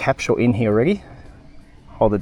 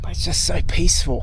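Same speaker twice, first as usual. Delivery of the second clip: whispering; 3 semitones higher than usual. Real, not acted. Quiet peaceful spot, whispering.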